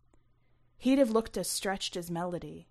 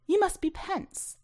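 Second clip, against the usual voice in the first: Melody's voice; 12 semitones higher, 4 dB louder than usual. A narrator's reading. Greeting with raised voice.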